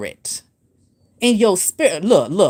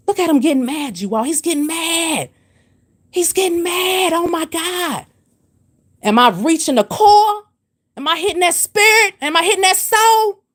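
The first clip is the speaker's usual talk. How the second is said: gleeful taunting; 8 semitones higher than usual. Real, not acted. Taunting a commenter who got mad.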